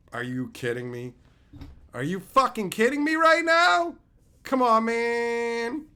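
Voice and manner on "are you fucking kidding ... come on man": loud, high disbelief at a bad pull; like the pack just robbed you; exasperated outburst. Real, not acted. Angry streamer reacting to a bad pull, yelling.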